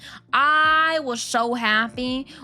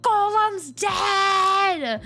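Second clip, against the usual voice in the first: gleeful retold cheer; 9 semitones higher, much slower than usual. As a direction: cheer like your enemy just died. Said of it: "I was like".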